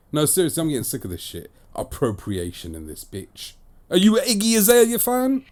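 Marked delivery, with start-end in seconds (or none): 3.9-5.4 high, loud, incredulous question; squeaky mock indignation; mocking challenge. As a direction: needle them with a pitched-up question.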